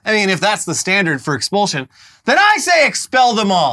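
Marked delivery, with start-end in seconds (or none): 2.2-3.7 high, emphatic mock-rally demand; fired up. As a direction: demand it like a rabble-rouser. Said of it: "I got a little fired up there".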